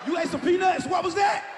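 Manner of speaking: panicked wife. Acted.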